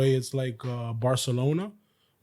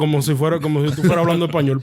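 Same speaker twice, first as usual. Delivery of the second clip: fast Spanish, a bit louder; Spanish accent; 3 semitones higher, 5 dB louder, much faster than usual. Shown as a demonstration. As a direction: rattle it off in Spanish. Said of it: "go with my Spanish accent".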